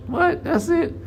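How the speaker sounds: hammed-up high what; mock disbelief. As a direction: act stunned at a weak punch. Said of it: "Like, psh".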